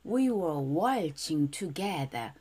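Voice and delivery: very slow, clear English line; slow teacher demo; slow model reading. Shown as a demonstration. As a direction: spell out each word slowly for learners. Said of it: "첫 문장 볼게요".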